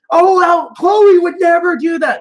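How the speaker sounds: high, loud mimicked objection; mock protest. Retelling the dispute over Chloe, mock protest. Acted protest in retold quarrel.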